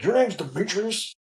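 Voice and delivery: high, slow disbelief at the name; mock-shocked officer reading the name; incredulous boss. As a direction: stretch the question out, disbelieving.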